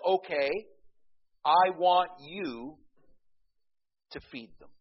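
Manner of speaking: slow, loud, each word pressed; Jesus firmly ordering the disciples to feed them; emphatic command. Retold or imitated. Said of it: "emphatically and absolutely says".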